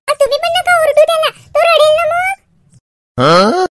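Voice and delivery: high-pitched appeal; pleading child. Acted. Girl warns that Shyam will cry, pleading.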